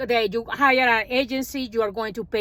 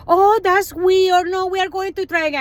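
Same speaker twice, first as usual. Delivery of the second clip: mock fake surprise; 8 semitones higher, 5 dB louder than usual. Agency mocked on phone.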